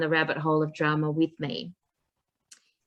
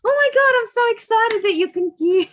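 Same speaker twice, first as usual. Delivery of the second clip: high, loud delighted squeal; excited squeal; 7 dB louder than usual. Retold or imitated. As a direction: gush with over-the-top excitement. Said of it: "And I'll be like".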